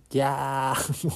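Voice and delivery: drawn-out mock scream; death scream. Sumiko and Kojiro die screaming, drawn-out mock scream. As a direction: scream as if being killed. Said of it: "ギャーで死ぬっていうことを表現".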